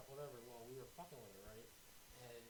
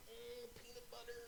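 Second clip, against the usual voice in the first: put-on Trailer Park Boys quote; 8 semitones higher, 5 dB quieter than usual. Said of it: "That's Trailer Park Boys".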